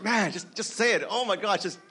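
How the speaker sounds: high, strained mock self-pep talk; nervous pep talk. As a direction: hype yourself up nervously before asking someone out. Nervous self-talk before asking out.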